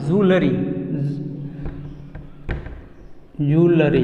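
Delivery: "ज्वेलरी" as joo-la-ree; "ज्वेलरी" in three syllables, joo-la-ree; corrected pronunciation. Shown as a demonstration. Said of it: "यानी ज्वेलरी बोलना है".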